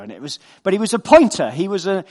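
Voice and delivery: normal talking voice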